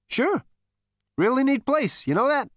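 high, loud brash Zaphod; cocky Zaphod voice. Acted. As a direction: play it cool and cocky.

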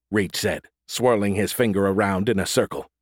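ordinary delivery

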